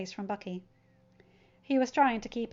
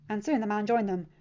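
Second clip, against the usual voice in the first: plain reading voice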